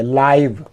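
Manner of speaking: लाइव with a long ai sound; लाइव said like the letter I plus v; लाइव, rhyming with five; pronunciation laiv. Shown as a demonstration. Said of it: "इसको आई भी यहाँ बोलेंगे".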